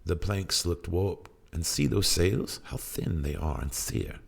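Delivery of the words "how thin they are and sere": usual speaking voice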